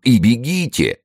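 high, exclaimed command; urgent cry. A narrator's reading. Friend pressing the ruined man to escape, urgent cry. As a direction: urge him to run at once. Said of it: "вскричал Пелесон".

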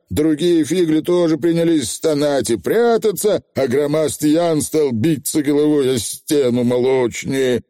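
ordinary delivery

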